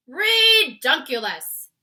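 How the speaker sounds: very loud, slow scoff; scoffing giant. A narrator's reading. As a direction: scoff loudly, dragging it. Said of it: "said the B F G".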